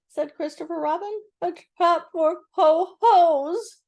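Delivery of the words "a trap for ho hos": high, loud little creature voice; small animal blustering; flustered Piglet. A narrator's reading. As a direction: push it out high and strained. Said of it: "said Piglet huskily".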